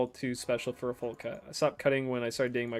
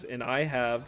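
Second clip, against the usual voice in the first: normal talking voice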